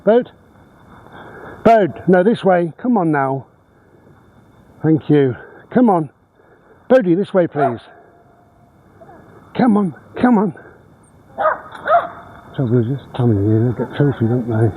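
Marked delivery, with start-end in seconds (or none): 0.0-10.5 calling the dog. Real, not acted. Owner repeatedly calling Bode this way, high coaxing call.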